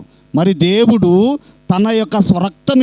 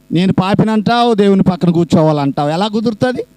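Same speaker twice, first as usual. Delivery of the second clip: ordinary delivery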